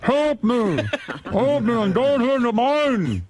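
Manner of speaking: high, slow mock Chilean accent; pleading miner in a hammy Chilean accent. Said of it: "I don't know how to do a Chilean accent".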